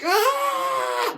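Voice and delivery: high battle yell; mock shriek as windows blow. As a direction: let out a shriek. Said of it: "She's like".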